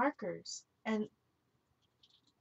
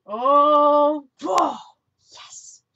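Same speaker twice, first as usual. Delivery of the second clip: excited; 4 semitones higher, 14 dB louder, much slower than usual. Real, not acted. Marker finally works, delighted.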